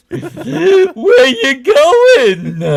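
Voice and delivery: very high, loud, drawn-out Ernie call. Retold or imitated. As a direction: stretch the Ernie whine out long. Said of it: "I've never done his voice before".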